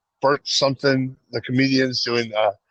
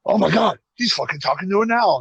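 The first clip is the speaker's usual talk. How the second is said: higher, amazed disbelief; mock amazed Bert; 7 semitones higher than usual. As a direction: act stunned, pitch up. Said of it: "Bert's like".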